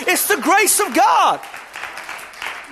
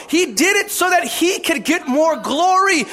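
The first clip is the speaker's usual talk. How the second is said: ordinary delivery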